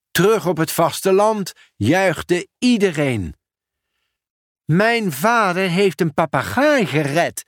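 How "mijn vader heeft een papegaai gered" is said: ordinary delivery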